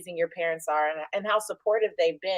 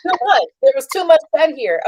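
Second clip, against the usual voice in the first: loud, high "too much"; overwhelmed exclaim; 4 semitones higher, 7 dB louder than usual. Real, not acted. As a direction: burst out, blown away by it. Host exclaims, overwhelmed by answer.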